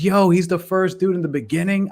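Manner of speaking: high amazed discovery; realization. Retold or imitated. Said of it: "I was like".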